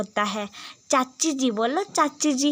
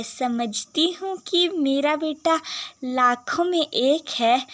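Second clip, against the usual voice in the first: ordinary talk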